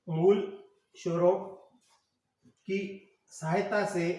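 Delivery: "मूल स्वरों की सहायता से" drawn out, with pauses between words; slow dictation. Shown as a demonstration. Dictates slowly for students writing.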